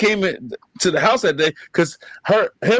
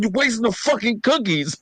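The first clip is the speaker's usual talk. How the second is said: higher, mock-outraged yelp over the ruined cookies; indignant kid protest; 6 semitones higher than usual. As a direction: play a kid upset that the cookies got crushed. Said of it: "And I'm like".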